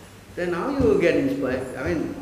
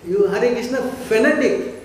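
loud, higher, scornful jeer of someone scolding a devotee; mocking a critic; 4 semitones higher, 5 dB louder than usual. Teacher imitating worldly people scorning a strict devotee, loud jeering. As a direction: sneer "fanatic" at him like an outsider. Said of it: "You speak like that".

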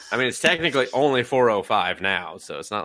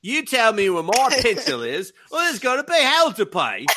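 put-on British schoolboy bully, pitched up; bad British accent; 11 semitones higher than usual. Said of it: "pad British accent".

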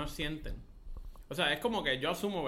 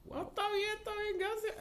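very high, small imitation of himself; high-pitched mock-awkward thanks; squeaky awkward politeness; 4 dB quieter than usual. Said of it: "yo ahí como que".

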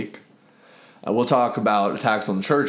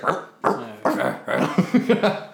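dog barking imitation; much slower than usual. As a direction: bark like a dog. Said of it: "And he's like".

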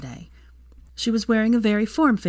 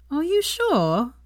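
teasing; 9 semitones higher, much slower than usual. A narrator's reading. Teasing slow question.

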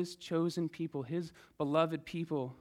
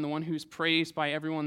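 ordinary talk, nothing marked

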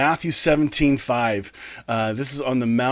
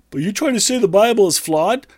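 raised, incredulous objector's voice; higher, louder mock challenge; imagined objector; 8 semitones higher, 4 dB louder than usual. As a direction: voice the doubting listener in mock protest.

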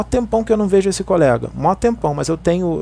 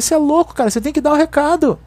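higher-pitched, insistent reproach; retold scolding; 9 semitones higher than usual. Medium telling colleague he must pass on the message, indignant retelling. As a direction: scold a friend in disbelief, pitched up.

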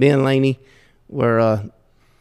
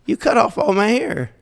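mock dismay; 9 semitones higher than usual. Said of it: "I said".

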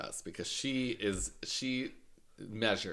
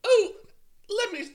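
put-on Australian accent; 8 dB louder than usual. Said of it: "oh, wait, she's Australian".

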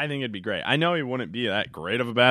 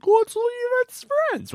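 very high, drawn-out squeak; slow, silly falsetto imitation; mock couples' voice; 4 dB louder, slower than usual. Host mimicking couples who just leave as friends, mock high voice.

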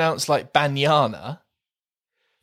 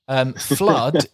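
normal talking voice

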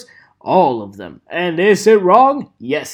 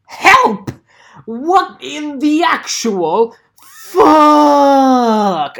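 angry shout; 5 semitones higher, 5 dB louder, much slower than usual. Acted. Frustrated outburst.

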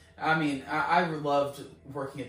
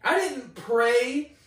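loud, high, stretched-out protest; incredulous protest; 7 semitones higher, 8 dB louder, slower than usual. Retold or imitated. Caller baffled by an unasked-for healing, incredulous. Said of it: "I'm like".

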